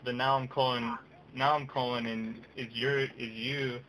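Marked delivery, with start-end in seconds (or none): none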